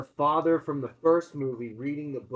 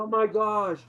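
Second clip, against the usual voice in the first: mock frightened cry; 8 semitones higher, 6 dB louder than usual. Daughters react in terror.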